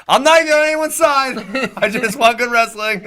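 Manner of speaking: very high, loud mock protest; imitating his own excited outburst; retold yelling. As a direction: shout a hammed-up defence of yourself, laughing midway. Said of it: "I was really loud".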